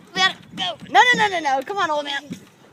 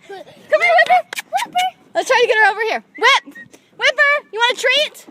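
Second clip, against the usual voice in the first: higher, louder coaxing dog voice; talking to a dog; 10 semitones higher, 5 dB louder, much faster than usual. Real, not acted. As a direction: coax the dog over, pitched up.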